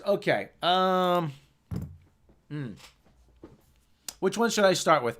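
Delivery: ordinary talk, nothing marked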